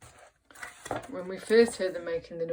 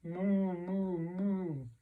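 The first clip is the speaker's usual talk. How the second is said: long, smooth cow moo; duck's moo; much slower than usual. A narrator's reading. Teacher voices the duck pretending to be a cow, mooing. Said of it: "the duck on the pond said".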